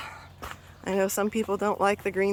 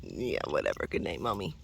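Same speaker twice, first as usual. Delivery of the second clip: grumpy dog voice; 9 dB quieter than usual. Pretend.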